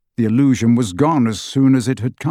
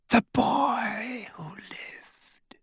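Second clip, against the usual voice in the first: high, extremely slow whisper; eerie, fading villain murmur; chilling soft voice; 12 semitones higher, 9 dB quieter, much slower than usual. A narrator's reading. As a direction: stretch each word out, hushed and high. Voldemort softly addresses Harry before killing.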